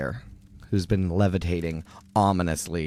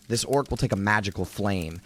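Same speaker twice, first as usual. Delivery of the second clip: ordinary talk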